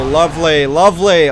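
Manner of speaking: pleased, loud and high; delighted. Real, not acted. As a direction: savour the kill. Celebrating a kill.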